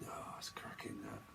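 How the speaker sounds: hushed whisper of cracking. Acted. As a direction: lean in and whisper it. Liam quote suddenly whispered.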